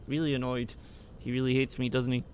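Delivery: ordinary talk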